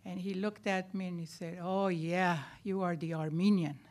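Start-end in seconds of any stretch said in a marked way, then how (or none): none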